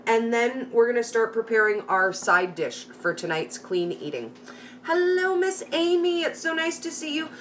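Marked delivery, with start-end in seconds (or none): 4.8-7.3 bright sing-song hello, pitched way up; sing-song greeting. Real, not acted. Host warmly sings hello to a viewer in the chat, pitched high.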